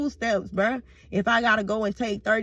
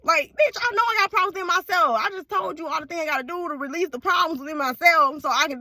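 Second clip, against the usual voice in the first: acted indignant retort; 8 semitones higher, faster than usual. Acts out arguing back.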